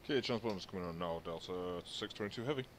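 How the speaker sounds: thin, distant radio voice; air traffic control radio. Real, not acted. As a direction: speak as if over a cockpit radio. Pilot radio readback.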